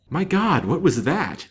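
sudden high, loud start; startled exclamation. A narrator's reading. Detective hears a strange sound in the room, violently startled exclamation. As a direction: jump at an unexpected noise. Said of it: "he exclaimed with a violent start".

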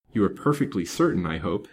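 plain reading voice

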